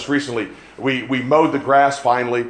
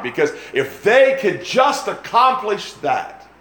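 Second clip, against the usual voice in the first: raised, emphatic sermon delivery; impassioned preaching; 4 semitones higher than usual. Real, not acted. Preacher warning the congregation about wasted lives, impassioned. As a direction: preach it hard, hitting the key words. Preacher drives his point home.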